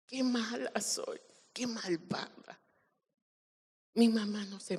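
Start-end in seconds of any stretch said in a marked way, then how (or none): 0.1-2.6 soft, regretful self-reproach; hushed, sorry inner voice; retold remorse. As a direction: quietly blame yourself. Retells self-reproach at mother's tears.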